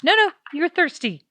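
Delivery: high-pitched insistent voice; mimicking pushy leader. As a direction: repeat it like she won't hear no. Mocking French leader's insistence.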